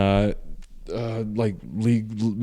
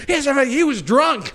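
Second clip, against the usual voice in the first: much higher-pitched mock mom voice; protesting mom; 12 semitones higher than usual. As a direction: imitate an outraged mom arguing. Mom protesting to dad, acted.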